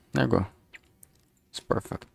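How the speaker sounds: usual speaking voice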